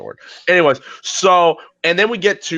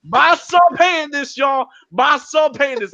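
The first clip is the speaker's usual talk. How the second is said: proud mama shout; 10 semitones higher than usual. Retold or imitated. Mama bragging to the crowd about her son, shouting high. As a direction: shout it out to everyone.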